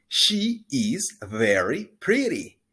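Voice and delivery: slow, clear pronunciation model; slow model sentence. Shown as a demonstration. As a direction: spell it out slowly for repeating. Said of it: "se pronuncia así".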